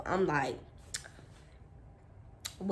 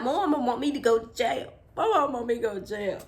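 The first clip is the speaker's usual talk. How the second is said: higher, louder mock wail; about to cry; 5 semitones higher, 4 dB louder, faster than usual. Child fears mama wants jail, tearful. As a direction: whine it as if tears are coming. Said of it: "about to cry".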